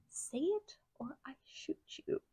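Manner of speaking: whispered threat, close and cold; menacing whisper. Retold or imitated. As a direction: hiss the threat under the breath. Threatening ex at gunpoint.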